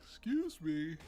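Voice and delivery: said through a yawn. Real, not acted. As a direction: talk while still yawning. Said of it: "I'm yawning and I'm yawning hard".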